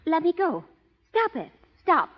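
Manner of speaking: high, loud gasping cries of stop; startled protest. Acted. Woman being grabbed by suitor cries out in protest. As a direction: she pushes him off, shocked and flustered. Grabbed and kissed against her will.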